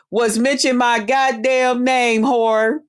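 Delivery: normal talking voice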